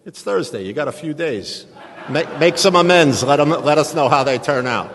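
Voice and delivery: loud, high, back-slapping push; loud goading. Retold or imitated. Guy prodding Tommy to make amends, loud goading. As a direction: call it out high and loud, egging him on. Sponsor type pushing him to act.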